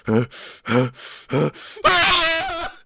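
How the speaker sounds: short huffs into a loud high cry; imitated huffing cry. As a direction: mimic three puffs, then a high wail. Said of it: "He looked at me going".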